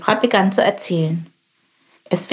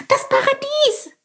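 very high, thrilled exclamation; excited cry. Acted. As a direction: burst out with delight. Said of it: "rief Chilp aufgeregt". (aufgeregter, sehr hoher Ausruf)

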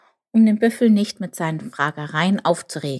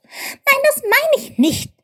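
very high piping little voice; squeaking; 6 dB louder, slower than usual. A narrator's reading. Chip corrects the misunderstanding buffalo, very high squeak. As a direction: protest in a tiny high squeak. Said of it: "piepste Chip".

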